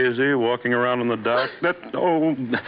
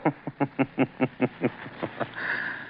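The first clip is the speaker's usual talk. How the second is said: short laugh; 7 dB quieter than usual. Acted. Laughing at worms.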